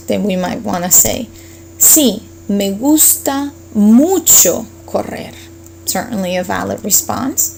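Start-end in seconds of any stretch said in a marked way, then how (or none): 1.8-5.3 "mucho" stretched and stressed, x0.6; slow down and lean on "mucho"; emphatic mucho. Shown as a demonstration. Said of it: "If you like it a lot".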